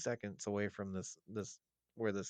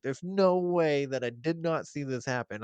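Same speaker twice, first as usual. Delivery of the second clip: louder, higher, pleading to be believed; insistent retold protest; 5 semitones higher, 9 dB louder than usual. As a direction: swear it's true, pushing hard over her doubt.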